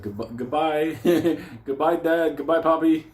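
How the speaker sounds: teasing singsong farewell; mock farewell. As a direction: wave them off cheerfully, mocking. Family leaving mockingly.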